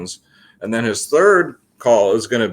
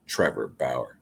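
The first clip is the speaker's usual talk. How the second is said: voice for the cat; 11 semitones lower, 10 dB quieter, slower than usual. Pretend. Voicing the cat's pick.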